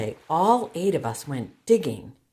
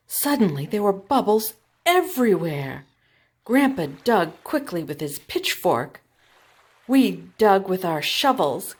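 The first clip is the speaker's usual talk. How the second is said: building excitement; 5 semitones higher, 6 dB louder than usual. A narrator's reading. Climax of clam digging.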